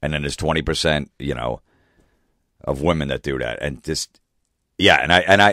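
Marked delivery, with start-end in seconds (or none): none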